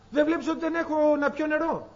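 high, plaintive complaint; complaining woman. Acted. Hagar complaining to God, high and plaintive. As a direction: whine your hardship upward.

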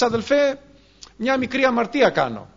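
high pleading excuse, lightly voiced; imitated objector. Churchgoer excusing a small sin to the preacher, mock plea. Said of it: "Μα θα μου πεις".

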